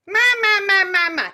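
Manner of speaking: loud, high nasal quack on ma. Shown as a demonstration. Voice teacher demos a quacky nasal ma for the class. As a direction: quack it out through the nose. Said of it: "any pitch you want, but kinda quacking".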